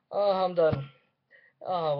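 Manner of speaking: usual speaking voice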